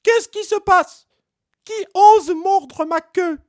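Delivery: very high, loud, slow outraged monkey yelp; jolted awake and furious; shrieking monkey woken by a bite; startled monkey outcry. Acted. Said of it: "se réveilla en sursaut".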